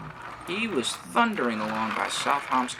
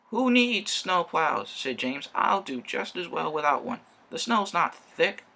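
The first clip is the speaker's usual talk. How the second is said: careless boast; 7 semitones higher than usual. Acted. James brushing off the snowplow warning, high and dismissive. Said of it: "he wheezed".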